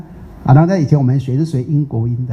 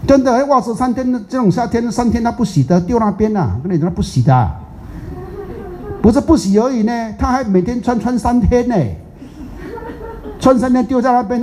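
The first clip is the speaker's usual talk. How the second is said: mock outrage; 9 semitones higher than usual. Real, not acted. Teacher exaggerates disgust at slob.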